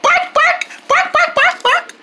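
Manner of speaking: high playful yapping barks; squeaky pretend barks with a laugh; pretend dog barking. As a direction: bark like a happy puppy. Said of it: "Let's bark and see".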